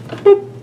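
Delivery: a playful cartoon pop as the box slides in; mock boop sound. Imitating the box snapping into place.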